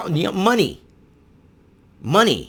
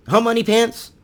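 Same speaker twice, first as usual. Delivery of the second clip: mocking jab, pushed harder; 11 semitones higher, 3 dB louder than usual. Mocking greedy colleges, repeated.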